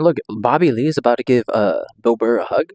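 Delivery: normal talking voice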